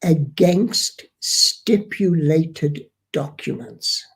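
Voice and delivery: "against stipulated documents" slow, word by word; "stipulated documents" measured out slowly for weight; key phrase emphasised. Shown as a demonstration. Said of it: "here comes the big one".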